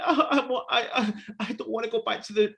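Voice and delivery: high-pitched whimpering little boy, hammed up; mock crying kid. Retelling a dinosaur film's scared twelve-year-old, mock crying. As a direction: imitate a child stammering through tears. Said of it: "'cause he's crying".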